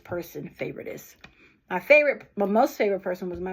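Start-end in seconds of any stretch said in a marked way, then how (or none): 0.6-1.1 favoritist breathed out as a soft whisper; whispered aside. Real, not acted. Repeating her grandmother's made-up word in a whisper.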